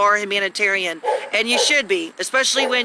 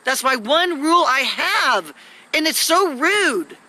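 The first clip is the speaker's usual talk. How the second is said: exasperated scolding; 6 semitones higher than usual. Real, not acted. Woman scolds her boyfriend for talking over her, exasperated.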